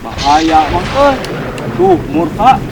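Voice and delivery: alarmed. Real, not acted. Alarm at the angry thunder king.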